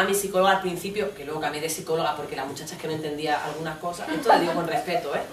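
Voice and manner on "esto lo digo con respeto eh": ordinary delivery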